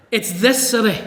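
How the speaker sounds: emphatic "this city" with a falling close; stress on this. Shown as a demonstration. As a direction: lean into "this" as if pointing at one city. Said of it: "the Greek it's a little bit more emphatic".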